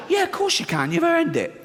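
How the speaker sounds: sarcastic cheer to the fat passenger; sarcastic reply. Retold or imitated. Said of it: "And I went".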